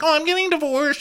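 very high, airy quote of a castmate; mock high-pitched announcement. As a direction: imitate someone announcing in a high voice. Quoting Kenya's breezy divorce announcement.

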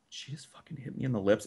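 stunned, barely audible; under your breath after the sister's slap; stunned disbelief. Retold or imitated.

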